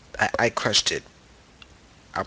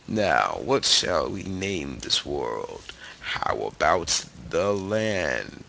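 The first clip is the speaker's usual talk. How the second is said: low, slow, whispery put-on deep voice; mock deep voice; 5 semitones lower, slower than usual. Vlogger naming his world, mock deep soothing voice. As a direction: keep the low soothing act going. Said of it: "soothe you with my deep voice".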